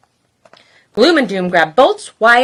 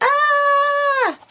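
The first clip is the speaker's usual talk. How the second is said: 1 mock scream. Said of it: "they're all like".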